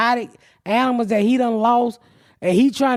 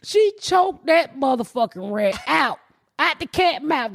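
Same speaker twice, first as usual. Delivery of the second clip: usual speaking voice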